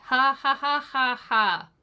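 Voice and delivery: sarcastic laugh. Pretend. Sarcastic fake laugh after poisoning joke.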